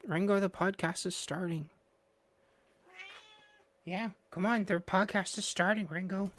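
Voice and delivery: high, carrying call to the cat; sing-song call for the pet to come. Real, not acted. Owner calling the cat Ringo over for the podcast.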